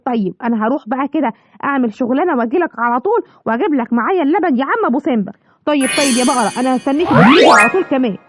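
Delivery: normal talking voice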